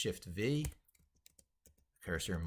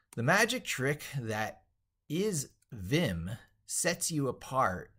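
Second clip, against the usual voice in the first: bright, emphatic book reading, higher and louder; animated reading aloud; 8 semitones higher, 7 dB louder, slower than usual. Acted. Streamer reading his own book to viewers, lively read-aloud. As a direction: sell the book's pitch like an enthusiastic author.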